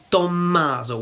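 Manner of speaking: Tommaso with a held double M; Italian-style Tommaso, "tom-MAH-zo"; Italian pronunciation. Shown as a demonstration. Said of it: "We would say it Tommaso".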